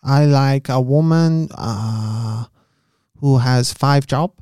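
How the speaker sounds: put-on slow, lightly accented Chinese voice. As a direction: drawl it in a mock Chinese accent. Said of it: "doing an accent".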